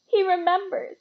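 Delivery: high, loud delighted cry; excited cry. A narrator's reading. Delighted man recognized by his hero, excited cry. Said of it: "cried Dedalus Diggle".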